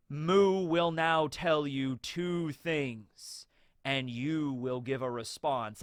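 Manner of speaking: very slow, drawn-out decree; pronouncement. Retold or imitated. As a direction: draw out each word like a pronouncement. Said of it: "just kind of tells Cobra things".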